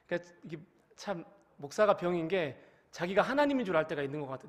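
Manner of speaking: usual speaking voice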